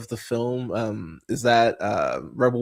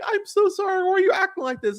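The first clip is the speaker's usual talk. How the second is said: much higher, a squeaky mock apology; mocking whiny apology; faster than usual. Mocking the lying spy friend's apology, much higher whiny voice. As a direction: parody them as pathetic and whiny.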